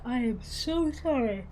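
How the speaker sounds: yawning through the words; words stretched by a yawn. Real, not acted. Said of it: "for all the yawning".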